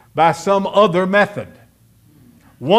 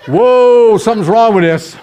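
alarmed realisation; 4 semitones higher, 9 dB louder than usual. Retold or imitated. Youth speaker realising his advice is flawed, alarmed. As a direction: burst out as doubt hits you.

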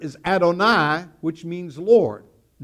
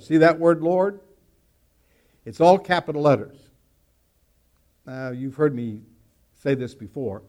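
ordinary talk

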